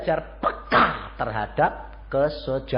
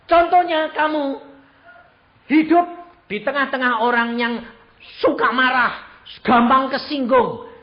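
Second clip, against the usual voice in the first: louder, higher preaching exhortation; 6 semitones higher, 7 dB louder than usual. Acted. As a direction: drive the lesson home to the congregation.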